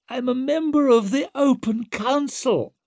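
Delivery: very high-pitched wail; cling to rank in a panicked high voice; panicked, indignant squeal; desperate protest. Acted. Condemned Wetterland protests his rank.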